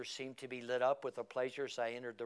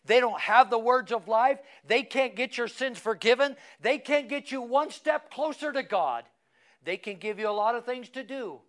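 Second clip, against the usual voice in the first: loud, higher pulpit crescendo; preaching at full force; 8 semitones higher, 7 dB louder than usual. Acted. Preacher pressing the congregation with loud, rising preaching. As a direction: build it up and hammer each claim.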